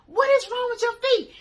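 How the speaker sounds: very high, loud shout; shouted question. Retold or imitated. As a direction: yell the question after her.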